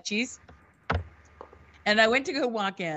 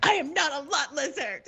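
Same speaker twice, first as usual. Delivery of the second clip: high, wailing mock protest; retold hammed-up indignation; indignant denial; 6 semitones higher than usual. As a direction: insist on it in wounded outrage.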